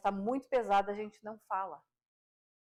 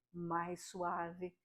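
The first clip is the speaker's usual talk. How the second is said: much softer, gentle; soft voice demo; 3 semitones lower, 10 dB quieter than usual. Shown as a demonstration. Voice coach showing viewers a softer voice, much softer. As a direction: ease off into a soft voice. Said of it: "você consegue elevar a sua voz".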